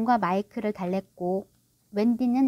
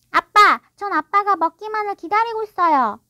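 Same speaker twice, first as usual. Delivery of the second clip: put-on high, loud child's voice; coldly insistent boy; 9 semitones higher, 7 dB louder than usual. A narrator's reading. Michael waiting for his father to drink, coldly. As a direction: press him with a touch of chill. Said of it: "마이클이 차갑게 말했다".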